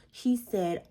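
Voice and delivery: usual speaking voice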